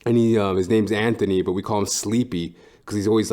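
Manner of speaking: ordinary talk, nothing marked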